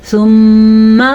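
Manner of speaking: ثم with a long, loud nasal "mm"; ghunna on meem. Shown as a demonstration. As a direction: hum through the doubled meem of ثم. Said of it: "میم کا غنہ ادا کرے".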